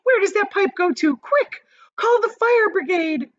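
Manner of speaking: high, loud frantic pleading; panicked. A narrator's reading. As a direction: shout orders in panic. Panicked mother demanding help.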